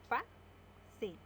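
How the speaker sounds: Fa-cil split into two beats; syllable by syllable. Shown as a demonstration. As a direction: break Fa-cil into fa and cil. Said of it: "Separamos en sílaba". (Fa-cil separada en sílabas)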